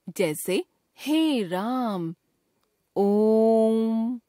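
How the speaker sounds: chant-like prolonged vowels; drawn-out pluta vowel. Shown as a demonstration. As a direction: hold the vowel three times as long, slow. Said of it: "ह्रस्व स्वर से तिगुना समय".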